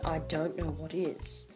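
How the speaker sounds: ordinary talk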